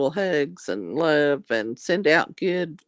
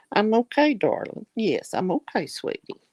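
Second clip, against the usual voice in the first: higher, laughing coo to the dog; laughing pet talk; 6 semitones higher than usual. Real, not acted. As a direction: giggle and reassure your pet. Laughing, reassuring dog again.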